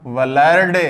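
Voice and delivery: वलर्ड with the l before the r, in two syllables; wrong sound order. Shown as a demonstration. Teacher warns of misplaced l, misordered वलर्ड. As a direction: say वलर्ड as va-lard, l first. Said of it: "ले पहले लिख दिया तो हो जाएगा".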